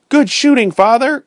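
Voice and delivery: high, loud cry of joy; excited cry. A narrator's reading. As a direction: shout the praise up high. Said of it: "cried Ernest".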